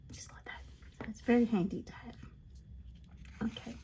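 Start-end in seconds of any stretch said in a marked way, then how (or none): 0.1-0.6 soft unvoiced whisper on Just like that. Real, not acted. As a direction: breathe the words Just like that without voice. Whispered crafting commentary.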